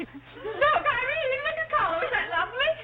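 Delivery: high, gushing encouragement; delighted coaxing. Acted. Mother urging daughter to watch Carlo's gorilla act, delighted coaxing. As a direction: coo with laughing delight, pitched well up.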